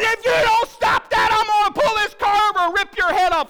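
very high, loud imitation of a wife exploding; over-the-top shouted ultimatum; mock enraged yell. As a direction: scream the threat, hammed-up. Wife explodes with threat, retold.

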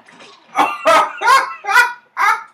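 very high, loud cackling fit; real hysterical laugh. Real, not acted. Friend entertained by stupid game moment, hysterical laughter. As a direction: lose it completely in shrieking laughter. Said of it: "I'm glad I have your laugh now".

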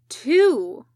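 loud, curt irritated answer; a patient annoyed at being questioned by the medic; bitterly vexed. Acted. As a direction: spit out the answer, fed up. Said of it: "sounding bitterly vexed".

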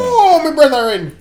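high, drawn-out Irish lament; mock Irish accent. Giving a rundown of life in Ireland, slow mock Irish. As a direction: draw it out slowly in a high fake brogue. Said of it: "what was it like in Ireland?".

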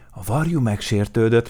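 ordinary delivery, nothing marked

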